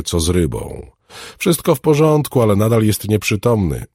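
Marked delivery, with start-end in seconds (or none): 1.4-3.8 put-on high, louder girl's voice; assuring little girl. A narrator's reading. Girl updates the alchemist on the patient, assuring. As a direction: assure him in a raised child's pitch. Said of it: "zapewniła dziewczynka".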